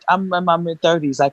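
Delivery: usual speaking voice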